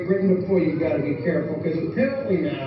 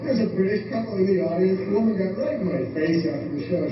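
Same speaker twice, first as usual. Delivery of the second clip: normal talking voice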